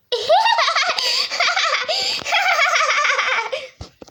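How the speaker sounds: high giggling laugh. Acted. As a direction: laugh it off brightly. Laughs after fake trip.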